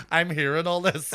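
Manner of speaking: ordinary talk, nothing marked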